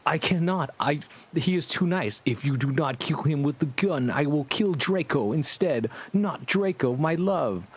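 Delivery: put-on high, dramatic girlish voice; mock melodrama, pitched high; hammed-up high melodramatic heroine; Ebony voice. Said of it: "And it's like".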